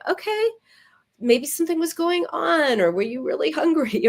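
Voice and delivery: lightly voiced caring friend; kind friend. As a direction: meet the friend with curiosity.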